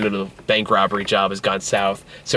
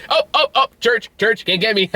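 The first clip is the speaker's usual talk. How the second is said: put-on high, playful child-like taunting; mock safe-zone gloating; 10 semitones higher than usual. Fugitive claims church as safety zone from police, playful taunt.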